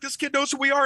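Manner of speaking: high-pitched mimicked excitement; excited mock voice, high; retold excitement. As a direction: imitate thrilled performers, pitch way up. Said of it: "walked over all excited".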